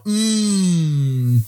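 long, high appreciative hum falling away; drawn-out yummy mmm; savouring mmm. Pretend. Guard shows off homemade tortillas, savouring hum. Mimes savouring food in imagined scene.

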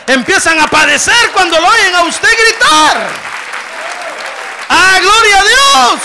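rising to a fervent shout, high and loud; preaching at full force. Acted. Pastor stirs up the congregation with a shouted preaching climax. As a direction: preach it out at the top of your voice.

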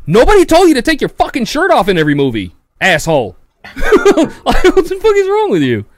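high, loud laughing outburst; rising into a high laughing shout; mock angry rant. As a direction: yell at him half laughing.

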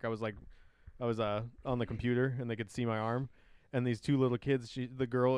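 ordinary delivery

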